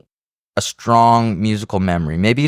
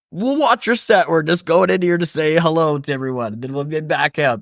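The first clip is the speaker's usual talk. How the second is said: innocent excuse voice; 8 semitones higher than usual. Retold or imitated.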